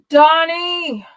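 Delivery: high, loud desperate cry; final desperate scream. A narrator's reading. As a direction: scream as the last hope goes. Last desperate call.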